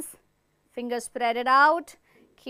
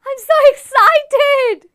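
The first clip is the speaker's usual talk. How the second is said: much higher and louder, drawn out; excited; 12 semitones higher, 14 dB louder, slower than usual. Shown as a demonstration. Teacher showing the class excitement, squealing high. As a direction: burst out thrilled and slow. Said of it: "that is excited".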